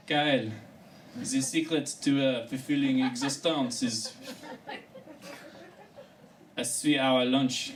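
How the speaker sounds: lightly put-on continental accent; mock European wisdom; European advice voice. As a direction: savour it like a leisurely European. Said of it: "They said things like".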